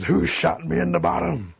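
high, indignant storybook bear roar; outraged bear. A narrator's reading. Bear pulls the dart from his bottom, outraged demand.

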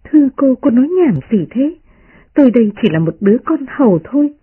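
lightly voiced servant's protest; protesting maid. A narrator's reading. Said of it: "đẩy Đại Ngọc ra nói".

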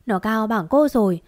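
ordinary delivery, nothing marked

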